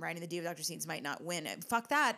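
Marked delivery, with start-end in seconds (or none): 1.6-2.2 sharp, loud dismissal; defiant outburst. Real, not acted. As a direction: brush it off loudly.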